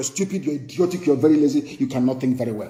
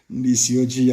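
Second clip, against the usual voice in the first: ordinary delivery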